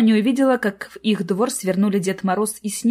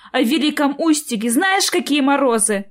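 higher-pitched scolding question; exasperated maternal warning; strict mother; 6 semitones higher, 3 dB louder than usual. A narrator's reading. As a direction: lecture the child sternly. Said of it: "строго сказала мама".

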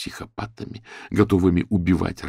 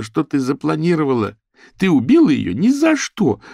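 high, loud, indignant accusation; angry rebuke; 8 semitones higher, 5 dB louder than usual. A narrator's reading. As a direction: lay into the killer in outrage. Daisy angrily rebukes Molly.

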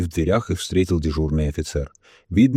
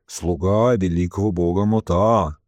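slow, drawn-out ceremonial answer; solemn intoning. A narrator's reading. Jeff tells the officer whom he serves, intoning. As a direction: intone it like a holy servant.

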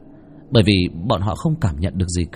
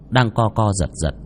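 usual speaking voice

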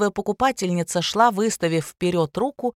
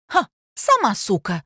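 higher, scoffing retort; scoff, then hurl the insult back; scornful insult; 5 semitones higher, 4 dB louder than usual. A narrator's reading. Women trade insults in quarrel.

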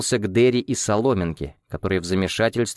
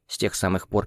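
ordinary delivery, nothing marked

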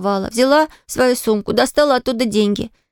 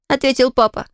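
plain reading voice

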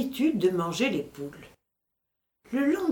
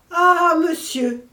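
higher, louder, slow anguished howl; the loudest, most terrified cry; terrible cry; 8 semitones higher, 7 dB louder, slower than usual. Acted. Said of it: "cria ces simples mots, mais trois fois répétés". (cri terrible, plus aigu et plus fort)

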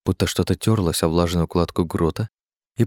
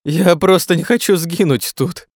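high, drawn-out tearful plea; whimpering, slow and high; sobbing; 10 semitones higher, 4 dB louder than usual. A narrator's reading. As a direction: wail it on the edge of sobs. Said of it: "снова всхлипнул Жером".

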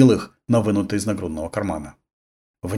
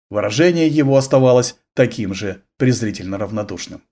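usual speaking voice

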